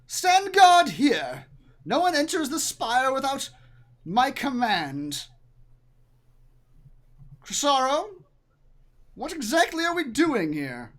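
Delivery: high, loud commanding woman; loud high-pitched lady in command; imperious female villain. Acted. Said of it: "the female voice says".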